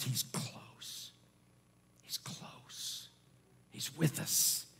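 hushed, sinking into a whisper; whispered closeness. Shown as a demonstration. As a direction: confide it softly.

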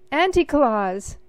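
shouting with joy. A narrator's reading. Sophie spotting her aunt on stage, shouting with joy. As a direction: call her name out loud in delight. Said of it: "Sophie shouted".

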